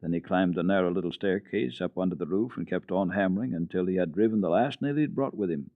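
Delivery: usual speaking voice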